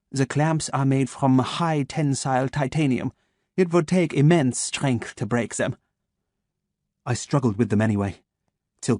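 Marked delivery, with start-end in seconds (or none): 0.1-5.8 put-on slow, crisp German-accented menace; measured German doctor, each word placed; a calm German voice waking his captive. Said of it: "his German accent crisp and sharp".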